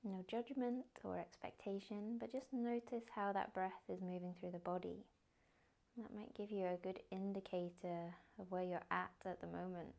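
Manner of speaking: soft, unhurried meditation guidance; guided meditation. Acted. Yoga teacher guiding the class into a body scan, soft meditation voice. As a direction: ease them into stillness gently.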